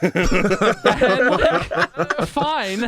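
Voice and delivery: high, loud laugh through the words; real laughter mid-story. Real, not acted. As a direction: laugh it out in disbelief. Laughing at the delivery guy.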